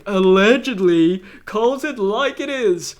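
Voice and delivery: lightly voiced announcer build-up, rising; a touch of big-introduction hype; mock ring announcer. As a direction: play the ring announcer, lifting the last words. Imitates announcer introducing Ventura.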